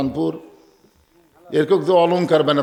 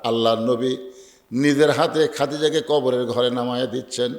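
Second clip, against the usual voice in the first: ordinary talk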